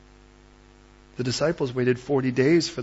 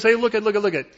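loud, raised attention-grabber; rapid-fire call for attention; urgent interruption; 8 semitones higher, 8 dB louder, faster than usual. Acted. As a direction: cut through the panic, insistently. God interrupting someone freaking out.

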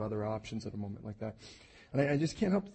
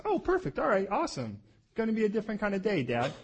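higher, fast sarcastic enthusiasm; mock cheer; 10 semitones higher than usual. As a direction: fake delight at being tied up. Mock cheerful bound Isaac.

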